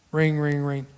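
mouthed phone ringing, flat and even; mock phone ring; phone ring imitation. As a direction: ring out like an old telephone. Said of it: "this is how it went".

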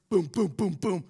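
four punchy, falling booms; imitated pounding booms. Friend rushing in the door, imitated booms. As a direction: boom it out like heavy footfalls.

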